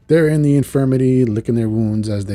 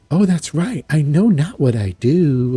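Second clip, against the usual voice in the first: higher, drawn-out sarcastic lilt; mocking; 5 semitones higher, 3 dB louder, slower than usual. [name] hears she's excused as controlled, mocking. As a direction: taunt with exaggerated innocence. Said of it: "like, mocking".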